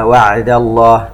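وعد with a firm, still ع; وعد الله with the ع cut clean; clear sukun. Shown as a demonstration. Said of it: "العين ساكنة لا نميعها". (وعد بعين ساكنة واضحة)

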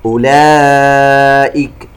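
chanted "أولئك" with long held aa; connected madd. Shown as a demonstration. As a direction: stretch the aa of "أولئك" four counts. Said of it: "مد متصل يمد بمقدار أربع حركات". (ترتيل بمد "أولئك" أربع حركات)

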